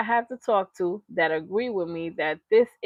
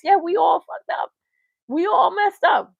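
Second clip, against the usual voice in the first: higher, louder confession; higher and louder, fired up; emphatic admission; 7 semitones higher, 4 dB louder than usual. Real, not acted. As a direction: own it passionately. Admitting everyone messed up.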